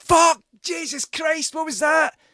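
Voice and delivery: screams "Fuck! Jesus Christ" in sudden panic; terrified scream. Retold or imitated. Narrator relives being startled in the dark by a noise. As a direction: shriek it like something just breathed in your ear. Noise right beside his head.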